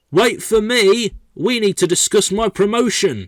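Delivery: high, loud call to wait. Acted. Employee chasing others about a promotion, calling out loudly. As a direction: shout after them, urgent and high. Calling after departing colleagues.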